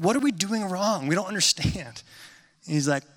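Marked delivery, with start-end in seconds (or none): 0.0-2.0 higher-pitched, mock-bewildered students; baffled complaint. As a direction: act out confused students asking for help. Students baffled by bad mix.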